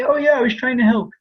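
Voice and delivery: high-pitched put-on excuse voice; mock innocence. Housing provider being untruthful about intentions, mock innocent.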